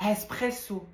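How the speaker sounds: "Espresso" with an Arabic-style breathy h at the front; botched Arabic-sounding repeat; Arabic-accented. Pretend. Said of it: "tu viens de le dire en arabe".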